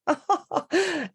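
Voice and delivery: high-pitched affected RP laugh; posh laugh. Shown as a demonstration. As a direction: demonstrate a fancy society laugh. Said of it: "teaching her how to laugh".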